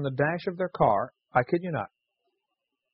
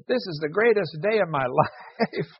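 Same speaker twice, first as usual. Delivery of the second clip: higher, drawn-out mock kid's elation; gleeful quote of an excited child; thrilled kid; 4 semitones higher, slower than usual. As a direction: imitate an overjoyed kid, stretching the words. Quoting a thrilled kid at play.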